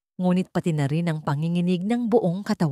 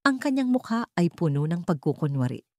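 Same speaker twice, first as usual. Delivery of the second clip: plain reading voice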